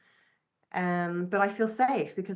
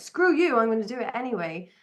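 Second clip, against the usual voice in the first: defiant outburst; 3 semitones higher, 6 dB louder than usual. Retold or imitated. Rebel pushing back against doubt, defiant loud burst. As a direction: spit it back at the world. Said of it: "sometimes we think about that defiance".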